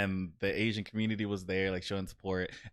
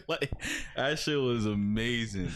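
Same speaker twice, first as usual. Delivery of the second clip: ordinary talk, nothing marked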